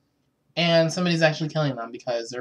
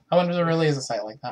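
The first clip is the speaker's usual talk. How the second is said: ordinary talk, nothing marked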